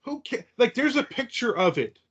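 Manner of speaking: high-pitched mock exasperation from commenters; mock exasperated arguing. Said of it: "people will argue".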